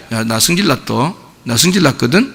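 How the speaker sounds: low, soft, understated grumble; mildly annoyed. Shown as a demonstration. Preacher shows too-weak anger for contrast, mildly annoyed and low. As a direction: say it small, barely angry at all. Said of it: "이런 정도가 아니에요".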